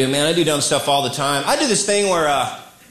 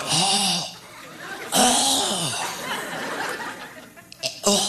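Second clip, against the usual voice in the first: West Coast voice; 3 semitones higher, 7 dB quieter, much slower than usual. Retold or imitated. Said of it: "If I'm on the West Coast, I'm like".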